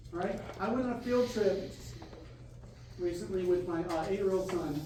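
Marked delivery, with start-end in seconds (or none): none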